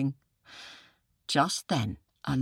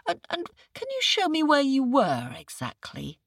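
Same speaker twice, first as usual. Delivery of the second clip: high, dopey questioning jackal; puzzled jackal; 8 semitones higher than usual. Acted. Jackal playing dumb.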